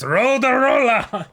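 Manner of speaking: high, loud, slow mock battle cry; hammed-up Eren shout. Joking cohost voicing Eren bursting out, high loud hammed-up shout. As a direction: bellow it like a silly anime hero. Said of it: "Eren just pops out".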